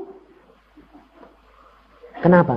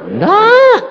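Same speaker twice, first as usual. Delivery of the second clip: sharp, high, loud refusal; indignant no; 7 dB louder than usual. Retold or imitated. Preacher refusing God's answer, high and loud. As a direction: snap back a short indignant no. Retorting to God.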